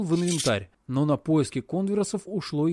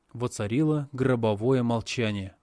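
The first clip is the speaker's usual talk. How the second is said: plain reading voice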